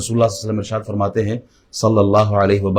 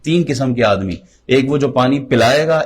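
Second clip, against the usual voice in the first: usual speaking voice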